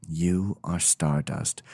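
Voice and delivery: low, slow, measured delivery; mock-solemn narration, drawn out; imitated video narrator. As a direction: intone it slowly like a documentary voiceover.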